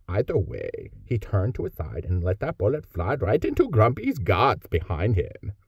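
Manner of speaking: Scandinavian accent. Shown as a demonstration. Narrator showing the author scene three in a light Scandinavian accent. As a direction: read the fight scene with a hint of Scandinavia. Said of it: "here's what scene three would sound like".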